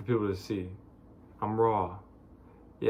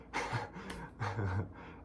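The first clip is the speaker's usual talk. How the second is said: soft laugh mid-rant; exasperated laugh; 9 dB quieter than usual. Real, not acted. After begging listeners to think, exasperated laugh.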